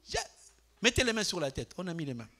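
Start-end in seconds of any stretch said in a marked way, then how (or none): 0.0-0.2 mock called-out Jesus. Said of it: "Dites-le trois fois".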